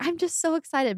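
giddy retold excitement, pitch lifted; excited mom. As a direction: imitate a mom who can't contain her excitement. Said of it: "my mom was so excited".

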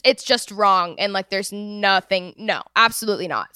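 ordinary talk

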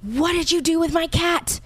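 high-pitched imitation of someone screaming; mock screaming. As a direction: retell a furious outburst, pitched right up without real volume. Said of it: "starts screaming at him".

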